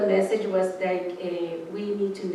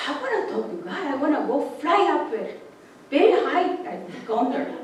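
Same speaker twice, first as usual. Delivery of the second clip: eager hummingbird; 7 semitones higher, 3 dB louder than usual. Retold or imitated. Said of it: "the hummingbird was like".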